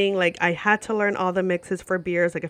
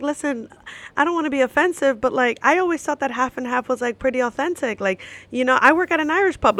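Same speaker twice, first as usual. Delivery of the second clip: defensive protest; 6 semitones higher, 4 dB louder than usual. Retold or imitated. Defending her credibility to Irishman.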